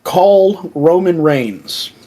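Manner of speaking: slow phone order. Retold or imitated. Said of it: "and he's like".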